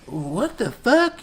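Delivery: high, drawn-out disbelief; mock bewilderment. Retelling Arnold getting up, slow bewildered exclamation. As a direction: act out a stunned double-take, slowly. Acting Arnold's shocked reaction.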